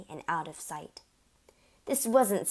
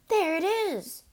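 high, loud outburst; excited cry; 12 semitones higher, 5 dB louder, slower than usual. A narrator's reading. Brother cries out to the family, alarmed and high. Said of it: "cried the brother".

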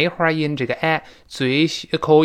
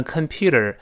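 American pronunciation. Shown as a demonstration. Teacher contrasting accents for learners, American pronunciation. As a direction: say "computer" the American way, t softened toward d. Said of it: "在美式发音读成computer".

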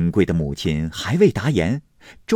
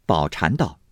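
ordinary delivery

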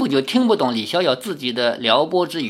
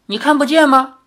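high, louder incredulous question; mild disbelief; 10 semitones higher, 5 dB louder than usual. A narrator's reading. Girl doubting that Li cannot see, incredulous. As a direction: ask it as if doubting him. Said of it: "少女不大相信".

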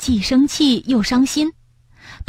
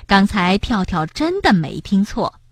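plain reading voice

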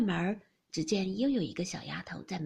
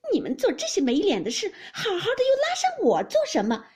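loud, high, aggrieved outburst; tearful cursing; 10 semitones higher, 8 dB louder than usual. A narrator's reading. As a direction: cry out in wronged anger, rising to a shriek. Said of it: "只气得干哭，骂道". (气哭着高声骂)

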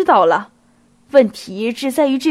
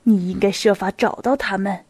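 usual speaking voice

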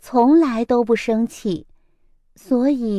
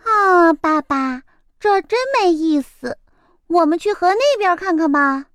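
bored little chick; 6 semitones higher than usual. A narrator's reading.

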